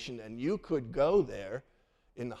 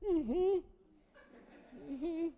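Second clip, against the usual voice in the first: put-on meek kids; 3 dB quieter than usual. Scared kids answering dad.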